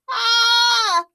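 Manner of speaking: high gleeful giggle; boy's giggle. A narrator's reading. Boy giggling at his trick, high giggle. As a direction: giggle like a prankster kid. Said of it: "laughing so hard".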